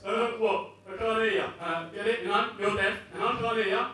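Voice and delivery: loud, mock taunting jab; a mate ribbing the deaf guy, hammed-up taunt; mocking joker. Strangers mocking his deafness, loud teasing jab. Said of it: "they make the same jokes".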